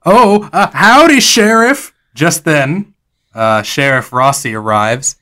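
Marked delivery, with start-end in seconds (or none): 0.1-1.9 flustered greeting. Acted. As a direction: caught out, higher and louder. Jonas caught by arriving sheriff.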